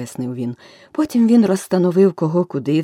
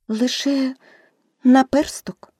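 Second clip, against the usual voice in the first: високий жалібний голос дівчинки; скажи високо, з легким сумом; сумно; 8 semitones higher, 4 dB louder, slower than usual. A narrator's reading. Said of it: "сумно мовила Аліса". (сумно, значно вище за оповідача)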